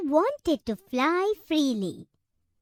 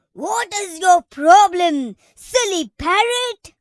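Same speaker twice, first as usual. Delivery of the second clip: angry elephant; 3 semitones higher, 9 dB louder than usual. A narrator's reading. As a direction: bellow it like an annoyed giant. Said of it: "The elephant got angry and said".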